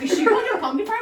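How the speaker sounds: high, loud incredulous question; friend's dad asking about the pumpkin farm, high and loud; mock surprise. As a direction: act out a dad's shocked disbelief. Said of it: "He's like".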